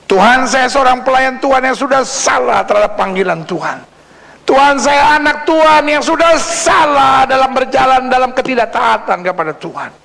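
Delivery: high pleading cry, easing at the end; impassioned confession. Acted. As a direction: cry out the confession to God, then settle. Models a sinner's confession to God. (seruan pengakuan dosa bernada tinggi)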